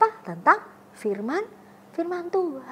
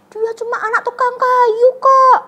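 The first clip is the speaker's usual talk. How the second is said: higher, louder contemptuous sneer; sneering villager; 10 semitones higher, 9 dB louder than usual. Retold or imitated. Villager scorns the carpenter's son, loud sneer. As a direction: spit out his lowly origin.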